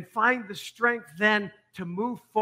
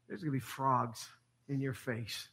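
hushed warning; 6 semitones lower, 11 dB quieter than usual. Acted. Moses threatening Pharaoh, hushed.

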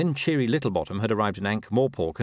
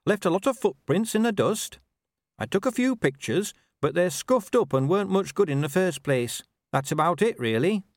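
brisk, higher dwarf voice reporting to her boss; eager young officer, pitched up; dwarf officer Cheery; 9 semitones higher than usual. Acted.

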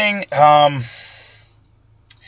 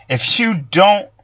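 mock Trump voice; 7 semitones higher, slower than usual. Mimics Trump's line, high and slow.

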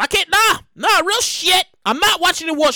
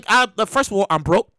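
usual speaking voice

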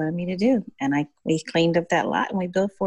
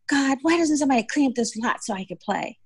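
put-on high-pitched little girl's complaint; imitated complaining child; 8 semitones higher than usual. Little girl with cut knee asks God, high childlike complaint. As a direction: cry up to God as a peeved kid.

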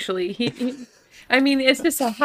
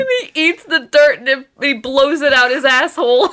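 louder, breaking up with laughter; laughing; 6 dB louder than usual. Real, not acted. Co-host adding a gross detail for the host, laughing. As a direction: fight through laughter to finish the gag. Cracking up over the dwarf.

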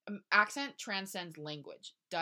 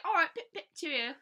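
high, loud, hammed-up British accent; mock British; 12 semitones higher, 7 dB louder than usual. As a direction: do a silly cheerio British caricature. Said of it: "Jordan would have been like".

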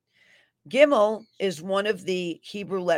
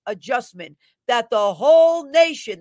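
"whole nation," pushed out loud and strong; "whole nation," thundered; capital WHOLE NATION; 4 semitones higher, 8 dB louder than usual. Acted. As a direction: drive "whole nation," home. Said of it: "that's capitalized".

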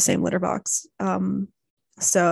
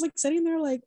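ordinary talk, nothing marked

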